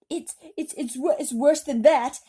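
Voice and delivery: loud, high stammering fright; cried out. A narrator's reading. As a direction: stutter it out, terrified. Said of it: "cried the chief of police".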